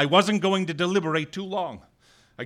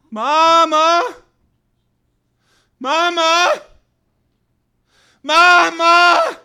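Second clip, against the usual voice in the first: loud high shout for Mama; 15 dB louder, much slower than usual. Acted. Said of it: "I was sure I was shouting".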